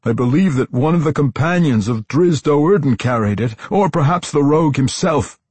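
desperate explanation. A narrator's reading. Tosin tries to appease the matron, desperate.

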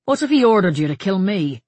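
lightly probing question, projected. A narrator's reading. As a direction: toss out the challenge lightly. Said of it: "Tighe's tone was light".

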